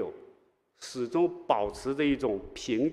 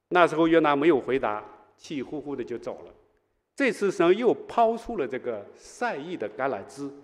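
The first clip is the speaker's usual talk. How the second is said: ordinary talk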